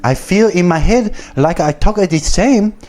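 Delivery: high-pitched, singsong fake Japanese-Brazilian accent; exaggerated mock foreign accent, pitched well up; butchered foreign accent. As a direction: imitate a woman with a botched Japanese-sounding accent. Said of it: "I'm butchering that accent".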